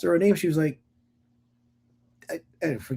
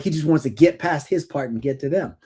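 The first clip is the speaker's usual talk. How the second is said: usual speaking voice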